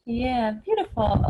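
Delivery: ordinary talk, nothing marked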